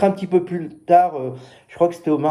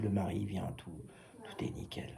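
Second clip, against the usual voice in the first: normal talking voice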